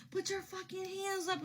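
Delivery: normal talking voice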